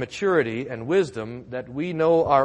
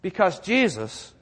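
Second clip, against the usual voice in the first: full force preaching; 3 semitones higher, 5 dB louder, slower than usual. Acted. Pastor hammers home Jesus' victory, fervent and loud. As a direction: preach it hard to the congregation.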